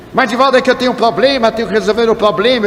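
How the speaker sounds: pestering plea. Retold or imitated. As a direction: rush through it, insisting on being heard. Man pesters him for a consultation.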